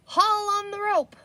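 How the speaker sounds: sheriff's cry. Acted. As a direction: call it out to the crowd. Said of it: "cried the sheriff again".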